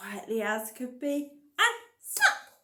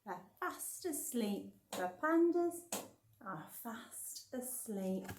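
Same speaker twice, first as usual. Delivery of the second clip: sleepy hush; 8 dB quieter than usual. Acted. Reading to children that the pandas sleep, hushed. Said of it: "the sleepy pandas".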